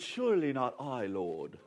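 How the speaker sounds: soft, low, mock British disciple; a gentle put-on British voice; British accent. Pastor voicing the disciple in a soft British accent. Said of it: "why he has a British accent".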